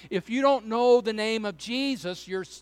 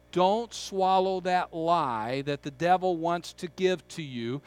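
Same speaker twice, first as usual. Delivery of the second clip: normal talking voice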